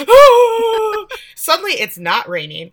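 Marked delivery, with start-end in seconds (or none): 0.1-1.1 put-on loud, high drawn-out child wail; mock kid crying. Daughter running off sobbing, mock little-kid wail. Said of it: "little kid crying".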